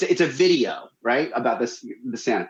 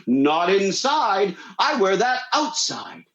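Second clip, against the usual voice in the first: slow, higher-pitched Santa insistence; 5 semitones higher, slower than usual. Retold or imitated. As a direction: draw out each word as a stubborn Santa. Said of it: "He goes".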